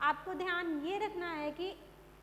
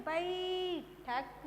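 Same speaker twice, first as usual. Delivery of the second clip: बैठक stretched slowly as ba-eee-thak; slow बैठक, weight on the first syllable; stressed long ai; much slower than usual. Shown as a demonstration. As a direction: hold bai in बैठक very long. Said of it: "हमने जोर देकर बोला".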